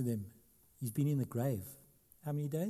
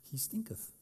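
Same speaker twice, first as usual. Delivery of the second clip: put-on softer, laughing over the old word stinketh; amused repeat; 9 dB quieter than usual. Shown as a demonstration. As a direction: chuckle as you say stinketh again. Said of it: "that's what it says".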